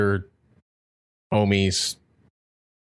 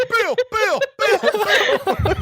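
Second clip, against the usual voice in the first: imitating the alert sound; 14 semitones higher than usual. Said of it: "it was like".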